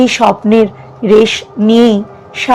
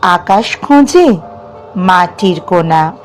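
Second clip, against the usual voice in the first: measured verse reading with long pauses between lines; poem recitation. Acted. Reciting a self-written poem about dreams to listeners, measured poetic delivery. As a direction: recite it phrase by phrase, lifting some line endings, letting others fall.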